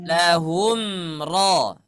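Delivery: Lahum with the m closed fully before ro; loud clear mim before ro; clear izhar. Shown as a demonstration. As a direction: sound the mim of Lahum cleanly, then ro. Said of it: "Di sini ada mim bertemu ro".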